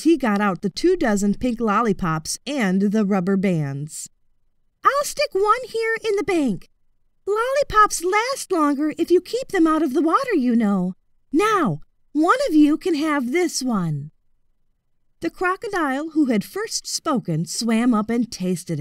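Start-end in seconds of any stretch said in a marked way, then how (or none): 4.8-14.1 put-on higher, polite boyish voice; high, courteous young voice; boy's high voice.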